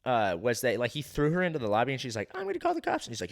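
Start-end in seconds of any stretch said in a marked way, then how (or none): 2.3-3.1 very high mimicked woman's voice; high-pitched impression of an upset woman; imitated woman. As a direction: mimic her threat in falsetto. Imitating woman threatening to call police.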